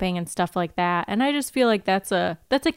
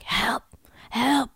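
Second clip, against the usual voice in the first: weak call for help; 4 semitones higher than usual. Retold or imitated. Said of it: "first she's like".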